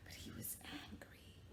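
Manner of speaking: hushed ominous whisper. Acted. Teacher whispering that the man was angry. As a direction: whisper before the outburst.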